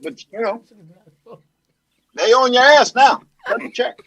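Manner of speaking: halting statesman, pitch lifted; president impression. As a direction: speak in short bursts with long pauses. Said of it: "you know that president that we miss".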